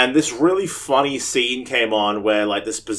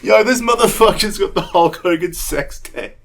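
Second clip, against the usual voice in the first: excited quote; 8 semitones higher, 4 dB louder than usual. Retold or imitated. Excited retold shout.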